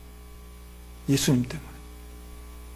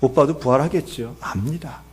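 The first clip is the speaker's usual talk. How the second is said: Martha's half-believing assent; 2 semitones lower than usual. Acted. Martha takes Jesus's promise as mere comfort, subdued assent. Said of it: "이 말은 뭐냐 하면".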